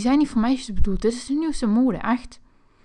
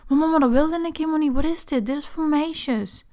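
higher-pitched, whining mama; higher, fast then slowing complaint; whiny protest; 6 semitones higher than usual. Acted. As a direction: plead like a wronged kid. Complaining to mom.

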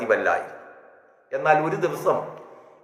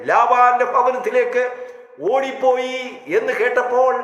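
raised, higher preaching voice, building; fervent preaching; 7 semitones higher than usual. Acted. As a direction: preach it out to the congregation. Preaching at full force.

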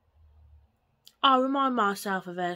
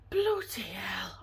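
whispered curse; 3 semitones lower, 4 dB quieter, slower than usual. Real, not acted. Muttered to herself on camera.

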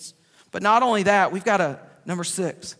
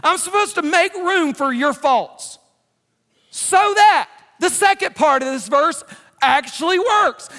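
loud, high-pitched preacher's drive; preaching at full force; 9 semitones higher, 6 dB louder than usual. Acted. Preacher urging the church to forgive, loud and driving. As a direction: preach it hard to the congregation.

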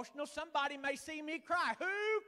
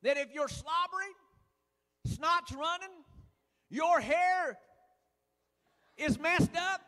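louder, fervent sermon delivery; rising preaching; 2 semitones higher, 6 dB louder than usual. Acted. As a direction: preach it out to the pews. Preacher building to full force.

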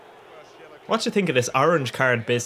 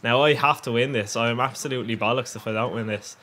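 ordinary delivery

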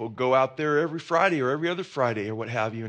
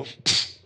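voiceless "Psst" like an air-gun shot; a quick breathy psst for the pellet gun; 4 dB louder than usual. Retold or imitated.

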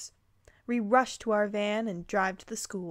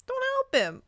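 squeaky plea. Pretend. Repeats in squeaky high voice.

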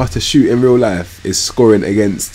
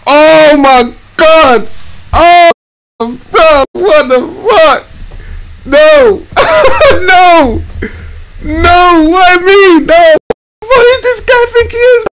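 high, loud despairing yells, much higher; shrieking, drawn-out protest; anguished shouting; 6 dB louder, much slower than usual. Real, not acted. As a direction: scream at the screen in disbelief. Reacting to a conceded goal.

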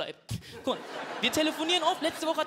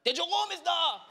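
high, drawn-out grandma call; imitated grandma calling; 4 semitones higher, slower than usual. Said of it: "schreit meine Oma". (gezogener, hoher Oma-Ruf)

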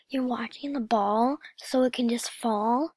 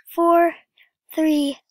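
loud countdown; 4 semitones higher, 8 dB louder than usual. Real, not acted. Counting down to the VIP ending, loud.

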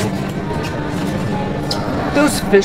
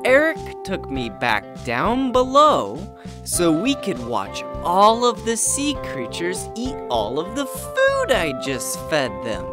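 voiceover narration; 5 semitones lower, 5 dB quieter than usual. A narrator's reading.

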